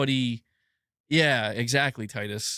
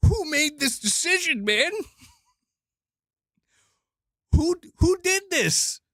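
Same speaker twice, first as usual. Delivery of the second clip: incredulous; 10 semitones higher than usual. Real, not acted. Exasperated outburst.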